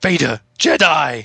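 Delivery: slow, loud put-on shout; mock shout. Host answers the joke with a silly shout. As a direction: bellow it slowly in a silly voice.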